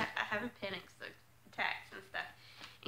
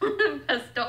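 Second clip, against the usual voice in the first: laughing; 2 semitones higher, 6 dB louder than usual. Shown as a demonstration. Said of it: "Something like that".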